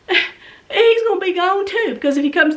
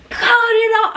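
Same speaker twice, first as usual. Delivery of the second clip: a higher, louder cut it off; high yelled command; 5 semitones higher, 3 dB louder than usual. Retold or imitated. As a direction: call it out hard and high. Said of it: "I'm out there going".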